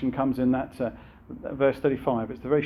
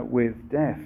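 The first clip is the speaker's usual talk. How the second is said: normal talking voice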